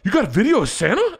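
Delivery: high, astonished question; lightly voiced amazed grandpa; amazed dad. Grandfather asking his son, mildly amazed. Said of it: "even my dad's like".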